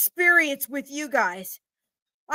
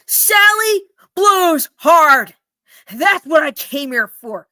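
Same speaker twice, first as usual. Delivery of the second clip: very slow, much louder; shouted reading; 9 dB louder, slower than usual. Acted. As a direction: hammer out Sally blows hard. Customer reads ad loudly.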